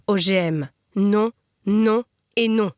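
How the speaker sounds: slow, spaced-out refusals; restrained protest cry. A narrator's reading. Crowd of reapers refusing GMOs, restrained protest cry. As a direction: chant each no slowly, as one crowd. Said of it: "un seul cri jaillit de leur poitrine".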